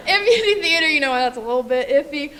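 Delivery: loud, giggly aside to the audience; laughing aside. Real, not acted. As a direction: laugh nervously through the words. Jokes about saying Macbeth in theater.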